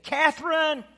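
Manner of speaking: high, loud drawn-out call from above; re-enacted father's long-distance shout; retold yell. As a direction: holler slowly to a lost child far below. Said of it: "I yelled out".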